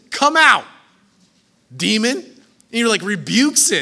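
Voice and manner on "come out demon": commanding rebuke. Retold or imitated. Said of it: "he says".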